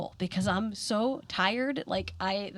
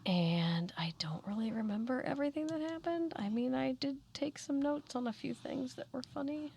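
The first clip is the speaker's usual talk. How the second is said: whispering; 4 semitones higher, 7 dB quieter than usual. Real, not acted. Unvoiced whisper measured.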